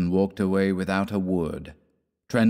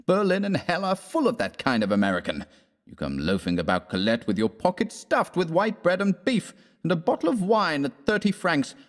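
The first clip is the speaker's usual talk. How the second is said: high-pitched, indignant tirade; angry; 7 semitones higher than usual. A narrator's reading. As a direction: scold him with rising indignation. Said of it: "was speaking angrily".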